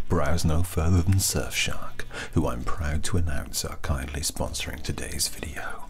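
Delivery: normal talking voice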